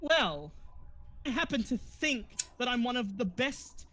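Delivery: high, huffy pride; offended actress. Acted. Actress defends her talent to the party, high and huffy. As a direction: sniff with wounded vanity, pitched high.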